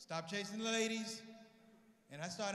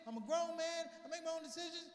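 frantic son; 8 semitones higher than usual. Retold or imitated. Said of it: "I said".

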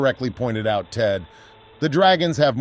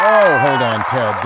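loud interruption; 7 dB louder, slower than usual. Real, not acted. Co-host interrupts.

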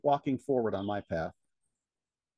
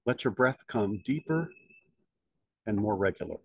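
guided meditation. Acted. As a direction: lead them gently inward, unhurried. Leads a meditation.

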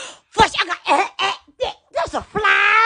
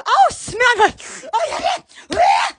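normal talking voice

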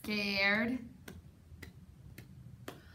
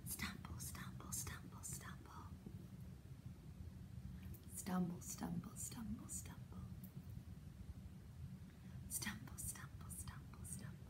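hushed unvoiced whisper, steady chant; whispered stumble; 5 semitones lower, 20 dB quieter, faster than usual. Reader whispers the forest steps to the children. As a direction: whisper each stumble like tiptoeing in the dark.

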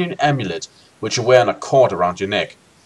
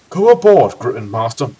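Remor's voice; 3 dB louder than usual. Acted. Mentor urging the Grandmaster aboard, corrected character voice. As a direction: urge it as the old mentor, less squeaky. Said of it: "urged Remor after replacing his vocal cords".

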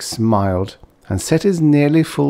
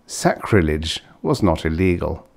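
ordinary delivery

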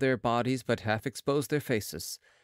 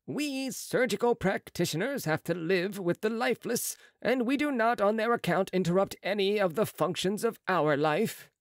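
higher, brisk surgeon's voice; a proud young surgeon, pitched up; Marat's voice; 6 semitones higher than usual. A narrator's reading.

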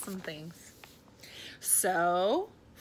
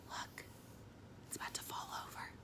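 whispered alarm; hushed aside; 17 dB quieter, much faster than usual. A narrator's reading. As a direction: whisper it quickly as a warning. Reader's hushed aside over the picture.

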